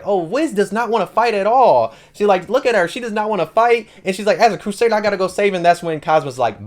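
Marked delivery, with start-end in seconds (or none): 0.0-3.9 high-pitched mock distress; worked up, voice lifted high; losing her mind. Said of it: "Darkness is gonna lose her mind". 4.4-5.6 high-pitched, worked-up declaration; frantic resolve, voice lifted; losing her mind. Retold or imitated. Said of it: "Darkness is gonna lose her mind".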